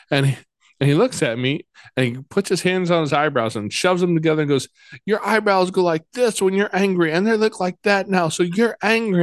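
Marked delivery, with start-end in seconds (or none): 5.0-9.2 put-on high, earnest little boy; explaining child. Foster child explaining his eyebrow clue to dad, high and earnest. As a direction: lay out the proof like a kid detective.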